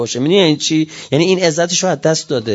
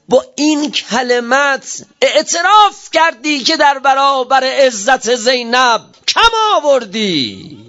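impassioned preaching; 7 semitones higher, slower than usual. Real, not acted. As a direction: drive it home to Yazid. خطاب تند به یزید در منبر. (با شور و تأکید)

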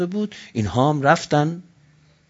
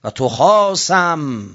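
slowly drawn-out recitation; 4 dB louder, much slower than usual. Acted.

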